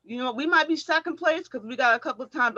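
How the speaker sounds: ordinary delivery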